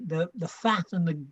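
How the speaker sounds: ordinary talk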